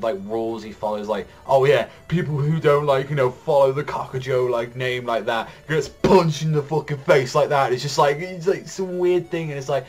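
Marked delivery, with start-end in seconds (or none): 1.5-7.7 raised mock-tough voice; loud mock threat. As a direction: bark it like a cocky thug. Character's rule quoted aggressively.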